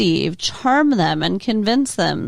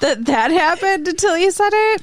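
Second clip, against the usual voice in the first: higher, laughing disbelief; 5 semitones higher than usual. Real, not acted. Reacting to co-host's grim plot summary, laughing. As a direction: talk through helpless laughter.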